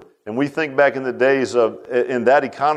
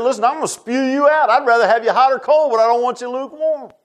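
high, raised preaching voice; retold stern rebuke; 9 semitones higher, 3 dB louder than usual. Jesus warning the lukewarm church, retold stern rebuke. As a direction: lay down a warning, pitched high and forceful.

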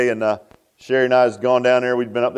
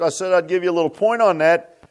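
ordinary talk

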